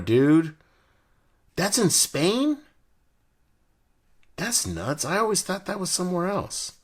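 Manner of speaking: genuine surprise, voice jumps high; can't believe it's in Spain; surprised. Real, not acted.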